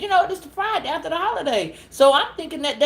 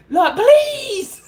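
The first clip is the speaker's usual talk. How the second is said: high laughing plea, louder; laughing mock prayer; 10 semitones higher, 7 dB louder than usual. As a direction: giggle out the prayer. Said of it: "Let her go downstairs".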